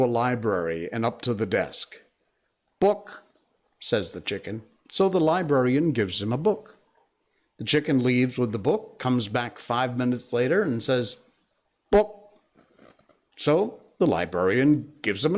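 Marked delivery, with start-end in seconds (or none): 2.8-3.2 higher clucking chicken asking for a book. Acted. Chicken asking the librarian for a book, higher clucky voice. 11.9-12.4 higher, louder clucked book; chicken voice. Acted. Chicken back at the library desk, louder higher cluck.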